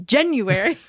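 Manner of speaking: January jumping high on the first syllable; exaggerated dad-style "January"; dad's January. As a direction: suddenly punch JAN in January. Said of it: "he'll say September".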